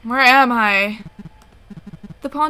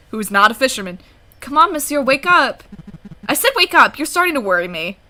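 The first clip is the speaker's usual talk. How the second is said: usual speaking voice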